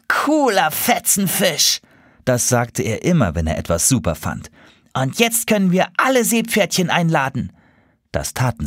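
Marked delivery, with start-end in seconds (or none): 0.1-1.8 high, drawn-out gleeful exclamation; delighted. Acted. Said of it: "wackelte er vor Vergnügen". 4.9-7.5 higher eager seahorse voice. Acted. Excited invitation plan.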